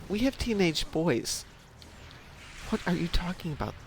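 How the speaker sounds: ordinary talk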